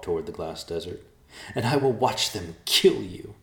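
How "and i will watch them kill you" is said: louder, spiteful promise; venomous. Acted. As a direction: threaten with venom. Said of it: "said with venom".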